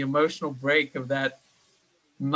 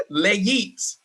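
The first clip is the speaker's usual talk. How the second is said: French with a slip into Russian; fake French accent; 3 semitones higher than usual. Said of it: "That got a little Russian for a second".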